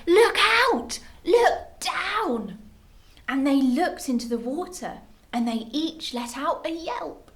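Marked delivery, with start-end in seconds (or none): 0.1-2.6 loud, high-pitched warning shout; yelled warning. A narrator's reading. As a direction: yell to warn of danger ahead. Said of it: "who yelled".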